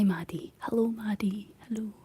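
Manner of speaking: normal talking voice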